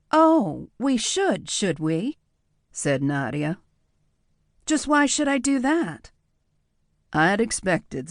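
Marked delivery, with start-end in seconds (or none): 0.1-2.2 a higher woman's voice, arch; coy challenge. Acted. Nadia playfully challenging. 4.6-6.1 much higher woman's voice, stress on why; higher-voiced, teasing why; playful test. Nadia testing him.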